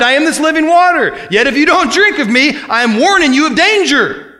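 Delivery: high, loud warning proclamation; announcing cry. Acted. Jesus warning the crowd of danger, loud proclaiming cry. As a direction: ring it out like a warning. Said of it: "We know that it was a cry".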